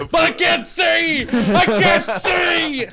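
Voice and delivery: high, drawn-out comic wail; pretend-blinded screech; mock panicked shout. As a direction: shriek it in hammed-up panic. Joking as a mutant shooting lasers.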